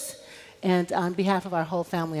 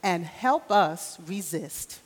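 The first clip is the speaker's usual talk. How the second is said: leading prayer; slower than usual. Real, not acted. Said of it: "Repeat after me".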